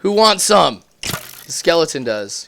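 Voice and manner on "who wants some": taunting. Acted. Taunting mob.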